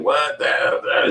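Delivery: high, drawn-out disbelief; mock shock. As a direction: imitate a stunned room, stretching the words. Acting out everybody's shocked reaction.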